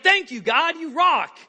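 high, loud grateful Jonah; cheery thanks. Retold or imitated. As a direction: thank God brightly. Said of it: "And Jonah's like".

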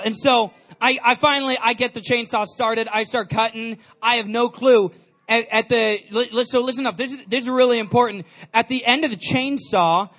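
ordinary talk, nothing marked

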